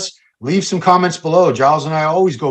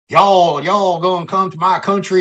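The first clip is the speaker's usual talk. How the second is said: hammed-up, higher Southern drawl; Southern American accent; 5 semitones higher than usual. Mocking other countries' go-to American accent, in exaggerated Southern. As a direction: mimic foreigners attempting a Southern accent. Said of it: "their go-to is always a Southern accent".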